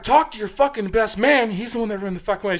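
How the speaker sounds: high, heated retort; arguing back. Retold or imitated. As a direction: fire the blame back. Bouncer arguing back with bride.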